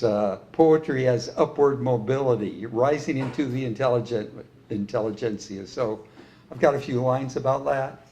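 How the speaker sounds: ordinary talk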